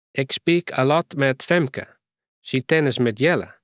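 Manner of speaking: put-on light English accent in broken Frisian; a foreign girl speaking mixed English and Frisian. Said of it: "Ze praten nogal mooi een accent". (licht Engels accent)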